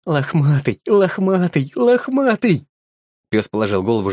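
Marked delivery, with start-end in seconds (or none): 0.1-2.6 whispering the name. A narrator's reading. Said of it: "шептать на ухо".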